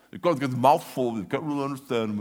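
lightly imitating a mumbling boxer; a hint of Rocky's slur; mumbled Rocky voice. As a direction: talk like your mouth is full. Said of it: "he would talk like this".

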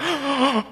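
big mock gasp of realization; high, drawn-in gasp; shocked gasp. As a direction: gasp as if suddenly found out. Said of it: "I was like".

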